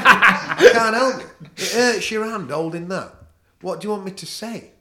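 mock a whining lad, half-laughing; mock whiny lad. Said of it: "Said to me".

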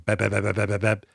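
rapid "bap" gunshot noises; imitated gunfire. Scarlett has the missing gun in use, imitated gunfire. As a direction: mimic a burst of shots with the mouth.

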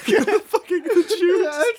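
high-pitched, laughing through the words; can barely get it out for laughing; laughing hard. Real, not acted. Cracking up at his juice story, laughing high through words.

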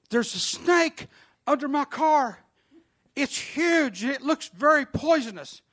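high, urgent frightened report; alarmed. Retold or imitated. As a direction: sound scared of the snake under the car. Frightened woman reporting snake.

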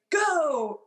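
high, drawn-out mock call; imitated command. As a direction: imitate an instructor calling the jump. Retold instructor's command to jump.

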